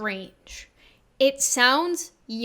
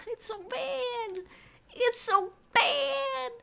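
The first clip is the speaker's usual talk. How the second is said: groaning despair; 12 semitones higher, 4 dB quieter, much slower than usual. Real, not acted. Cringing at own old video.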